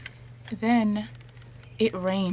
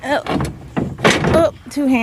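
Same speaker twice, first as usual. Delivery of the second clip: straining; 6 semitones higher, 7 dB louder than usual. Real, not acted. Lifting heavy panel.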